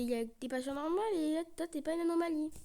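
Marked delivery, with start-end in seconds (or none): none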